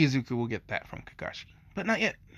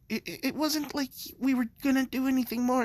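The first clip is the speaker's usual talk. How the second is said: put-on high flustered girl; 11 semitones higher than usual. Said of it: "Momo's just like".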